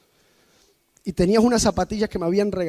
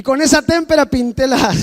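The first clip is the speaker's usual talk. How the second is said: laughing; 5 semitones higher than usual. Real, not acted. Laughs at own story.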